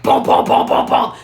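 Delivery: hard, rapid booming thuds; imitated police knock. Shown as a demonstration. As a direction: pound out a cop's knock by mouth. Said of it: "knock like a fucking cop".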